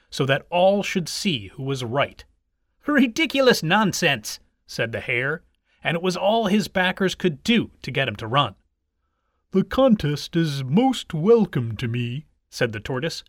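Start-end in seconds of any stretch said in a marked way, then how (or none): 2.8-4.4 higher-pitched scoffing exclamation; scornful hare. A narrator's reading. Hare dismisses the race to his backers, scornful. Scornful hare's quoted retort. 9.5-12.2 slow, deliberate tortoise; a ponderous, formal character voice. A narrator's reading. Tortoise accepts the hare's challenge, slow and stately.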